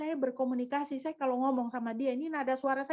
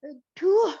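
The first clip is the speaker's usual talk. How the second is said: annoyed groan; 6 semitones higher, 9 dB louder than usual. Retold or imitated. Listener annoyed by high tone.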